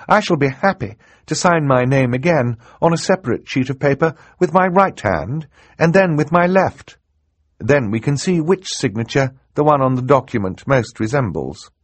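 put-on high, clear-minded young girl; confident girl. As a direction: propose the test step by step. Girl heroine voiced in narration.

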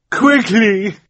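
high, loud call across the water. A narrator's reading. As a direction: call his name one last time. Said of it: "Violet called one more time".

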